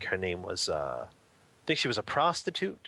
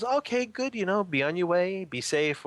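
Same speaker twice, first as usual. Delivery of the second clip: lightly voiced, higher-pitched hostess; see the traveler off in a raised voice; higher, acted farewell; polite send-off; 8 semitones higher, 3 dB louder than usual. Woman sends monk off in story.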